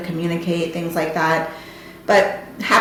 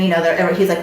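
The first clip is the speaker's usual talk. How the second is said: usual speaking voice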